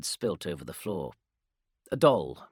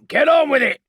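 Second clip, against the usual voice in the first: barked order, high and loud; impatient sergeant barks from the doorway, loud; commanding sergeant; 15 dB louder than usual. Acted. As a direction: snap the order at the squad. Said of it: "said Zalsk".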